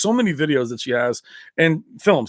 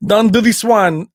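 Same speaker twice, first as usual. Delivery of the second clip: mock Van Damme; 6 semitones higher, 7 dB louder than usual. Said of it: "The way he spoke".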